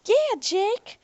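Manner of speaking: high, piercing call; shrill woman calling to Jake; woman egging Jake on, high and shrill. Acted. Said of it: "said one of the women shrilly".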